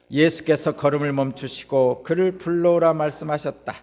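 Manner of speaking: ordinary talk, nothing marked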